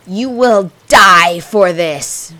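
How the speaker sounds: slow, loud venomous threat; vowing revenge. A narrator's reading. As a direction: swear vengeance like a witch. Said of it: "she swore".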